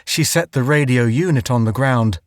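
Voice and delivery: usual speaking voice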